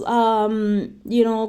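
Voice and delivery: ordinary talk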